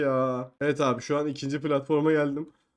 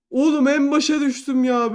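usual speaking voice

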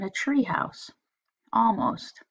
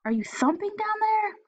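put-on much higher child call; shouting boy; 13 semitones higher than usual. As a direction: call down the stairs, high. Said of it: "he shouted".

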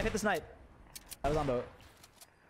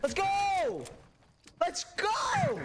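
high shouted cheer, building; excited cheer; 10 semitones higher, much slower than usual. Real, not acted. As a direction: hype up the teammate. Cheering a teammate's kill.

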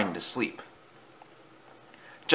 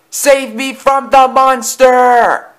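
long, high, full-voiced scream; screamed plea; 9 dB louder, much slower than usual. A narrator's reading. Thomas begs to be saved, slow high scream. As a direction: drag out a desperate shout for rescue. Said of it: "he cried".

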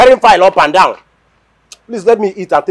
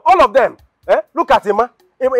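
ordinary talk

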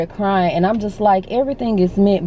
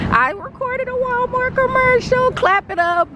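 high, thrilled reveal of big news; excited announcement; 13 semitones higher than usual. Real, not acted. Vlogger telling her viewers, much higher thrilled announcement.

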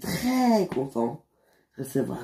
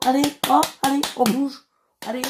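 loud hurrying; 11 semitones higher, 4 dB louder, faster than usual. Acted. Boss hurrying staff to work.

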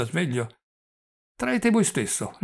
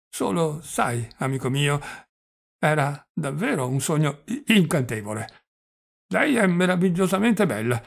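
put-on higher, stammering old man savouring a memory; doddering old prince, dreamy; 4 semitones higher, 4 dB louder than usual. Old prince telling his nephew about a beautiful woman, higher and stammering. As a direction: stammer fondly over the lovely dream.

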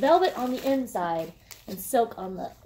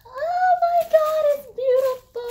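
ecstatic squeal; 11 dB louder than usual. Real, not acted. Vlogger squealing as she first sees the purse, ecstatic. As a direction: shriek with joy at first sight.

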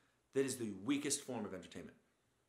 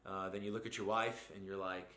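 usual speaking voice

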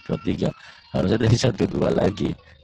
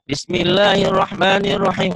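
Bismillahirrahmanirrahim recited higher and louder; bold confident reading; 7 semitones higher, 3 dB louder than usual. Shown as a demonstration. Teacher shows pupil the right, confident recitation. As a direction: read it out boldly, no fear. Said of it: "Harus gitu".

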